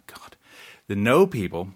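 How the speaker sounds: ordinary talk, nothing marked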